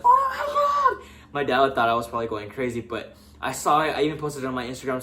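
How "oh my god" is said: high, slow, laughing squeal of "Oh my God"; mock excited scream. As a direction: shriek it up high, slowly, through a laugh. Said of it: "I was screaming".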